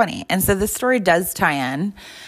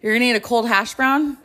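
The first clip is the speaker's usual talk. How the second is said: usual speaking voice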